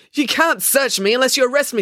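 high, forceful objection; indignant protest. Acted. Maya protests a search.

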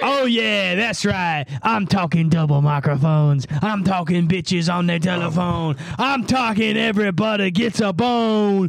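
big hype intro; hype announcer. Acted. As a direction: pump up the crowd. Hype-man intro over music.

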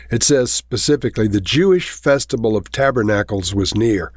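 ordinary talk, nothing marked

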